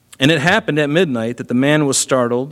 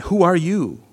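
startled question; 6 semitones higher than usual. Retold or imitated. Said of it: "And he said".

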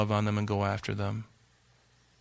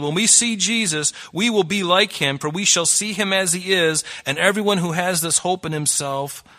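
raised, ringing reading of the verse; fervent scripture reading; 6 semitones higher, 6 dB louder than usual. Acted. Preacher reading First John to the congregation, fervent. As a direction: proclaim the verse high and full.

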